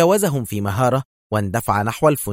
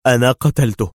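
decisive; 5 dB louder than usual. A narrator's reading. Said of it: "أجاب في حسم".

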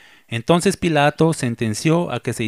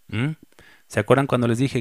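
ordinary delivery

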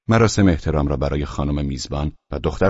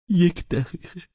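agitated; 13 semitones higher, slower than usual. Acted.

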